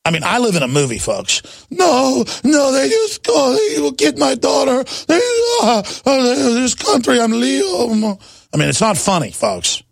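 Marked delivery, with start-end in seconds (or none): 1.7-8.2 high, sobbing imitation of a frightened relative; a phone caller breaking down in terror; imitating crying relative. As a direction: wail it through tears, pitched way up. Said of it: "calls back crying thirty minutes later".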